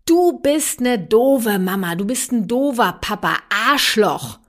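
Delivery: playing the cursing child; angry child insult. Mother voices her child insulting her, mock angry. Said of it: "Dein Kind sagt nun zu dir".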